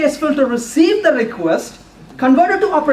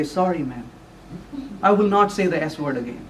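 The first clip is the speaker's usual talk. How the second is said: low, soft mock contrition; retold humbled promise, voice dropped; sheepish apology; 7 semitones lower, 7 dB quieter than usual. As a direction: apologize meekly, like a scolded husband. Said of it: "had to put my head down and say".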